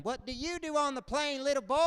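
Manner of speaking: put-on high, patronizing old man talking to a child; condescending old veteran. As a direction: talk down like he's speaking to a little boy.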